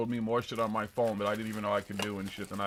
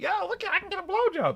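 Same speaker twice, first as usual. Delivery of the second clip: put-on high excited kid voice; bratty kid voice; 4 dB louder than usual. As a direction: play a boastful twelve-year-old. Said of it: "this kid's out there like".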